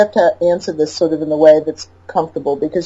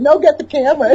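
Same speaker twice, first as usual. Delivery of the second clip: insistent wife; 9 semitones higher than usual. Retold or imitated.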